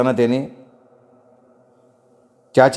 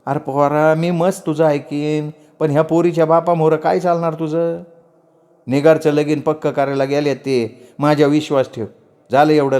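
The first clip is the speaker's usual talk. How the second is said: higher-pitched, piteous aunt; softened, pleading chachi; 4 semitones higher than usual. Acted. Aunt begs the boy not to bring disaster home, piteously. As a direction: plead helplessly, pitch raised. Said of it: "हळू आवाजात केविलवाणा चेहरा करत".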